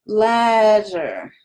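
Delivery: Ladder with one quick tongue flap; flapped ladder. Shown as a demonstration. Teacher showing a flapped Ladder to learners. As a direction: tap the d in Ladder once like a flap. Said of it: "working on your ladder".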